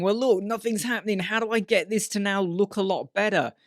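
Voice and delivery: mock panic. Tutor voicing a stuck learner, mildly panicking. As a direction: act a touch flustered, pitch lifted. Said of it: "you might start panicking and going".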